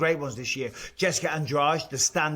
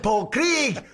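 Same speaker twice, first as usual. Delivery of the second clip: Paul Craig in a higher mock Scottish accent; teasing Scottish accent; 10 semitones higher, 4 dB louder than usual. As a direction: ham up the Scots on the name. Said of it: "And you're not from Scotland".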